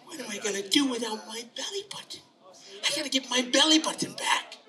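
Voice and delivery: put-on little boy fretting to himself. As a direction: worry aloud in a small, high voice. Tommy frets alone in the story.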